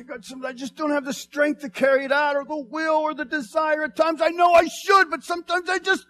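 high, loud, shouted confession to God; loud honest plea. Acted. Believer shouting his weakness honestly to God. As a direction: cry it out, holding nothing back.

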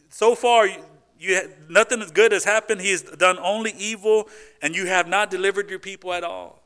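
hammed-up grumbling to God; whiny complaint. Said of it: "his response is".

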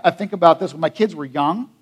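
usual speaking voice

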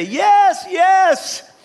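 high, loud, frantic yes; desperate agreement. Acted. Hanging man tells the voice he trusts it, frantic yell. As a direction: cry out eagerly to be rescued. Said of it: "he cried out".

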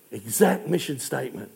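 quiet awed repetition; soft emphatic echo. Real, not acted. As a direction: let the repeat of Exact fall hushed. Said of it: "repeated softer".

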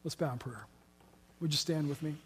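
hushed pastoral prayer closing the sermon; quiet prayer. Real, not acted. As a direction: pray softly and earnestly to God. Leads closing prayer softly.

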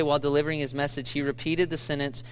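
usual speaking voice

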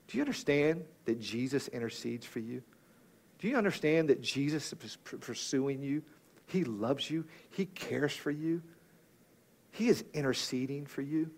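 soft, hushed, earnest preaching; tender reassurance. Real, not acted. Preacher telling congregation that Jesus intercedes for them, tender and reassuring. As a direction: assure them gently, voice held well down.

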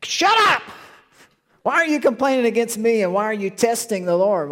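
high, loud furious shout; exasperated Moses losing it. Retold or imitated. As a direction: blow up at the grumbling crowd. Moses yelling at complainers.

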